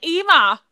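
"Emma" said as "EE-mah"; long ee at the start of "Emma"; mock Australian pronunciation. American host mimicking how Australians say Emma, "Emma" as "EE-mah". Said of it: "they say it like".

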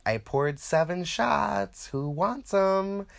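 high, slow sing-song offer of shots; party host call. Retold or imitated. Party host offering shots to the room, high and drawn out. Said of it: "I'm like".

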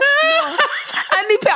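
high, pleading cry to auntie; mock tearful begging. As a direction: beg auntie like a crying kid. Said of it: "I'm crying".

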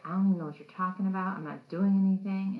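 loud, imitated protest of innocence; mock defensive protest. Billy answering Zach's screaming, loud mock protest. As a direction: act out a baffled denial, pushing the volume.

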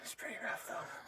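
barely voiced whisper; very soft, whispered jab; quiet aside. Real, not acted. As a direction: slip it in under your breath. Muttered aside under partner's defense.

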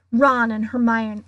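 plain reading voice

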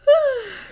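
big, high audible yawn. Real, not acted. Sleepy podcaster interrupts the chat, real loud yawn. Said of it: "I yawned".

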